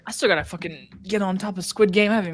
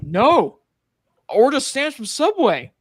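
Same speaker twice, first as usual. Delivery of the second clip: higher, louder mock exasperation; exasperated denial; 8 semitones higher, 5 dB louder than usual. Man explaining his disappointing trip, exasperated. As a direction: insist on it, pitch climbing as you go. Acting out film's trip punchline.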